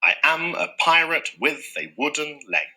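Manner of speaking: every second syllable lifted: i AM a PIrate WITH a WOODen LEG; iambic line. Shown as a demonstration. Teacher demonstrating a silly sentence in iambic pentameter. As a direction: bounce the sentence along in iambs. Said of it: "a sentence that just happens to be in iambic pentameter".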